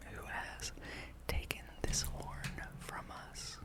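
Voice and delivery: normal talking voice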